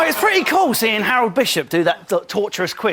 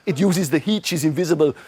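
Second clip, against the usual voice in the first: Predator movie voice; 5 semitones lower than usual. Retold or imitated. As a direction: hush it like a jungle soldier. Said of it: "like Predator or something".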